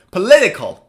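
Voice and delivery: "Political" in one go, LIT punched loud; quick "Political" peaking on the second syllable. Shown as a demonstration. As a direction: hit LIT in a joined-up "Political". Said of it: "Now say it faster".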